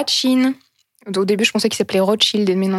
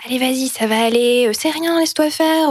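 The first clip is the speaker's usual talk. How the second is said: higher, gentle coaxing voice; coaxing the horse; 3 semitones higher than usual. Retold or imitated. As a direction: soothe a nervous horse, a little higher. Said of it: "elle lui dit". (une élève rassure doucement le cheval, voix plus aiguë)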